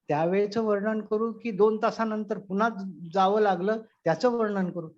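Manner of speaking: ordinary talk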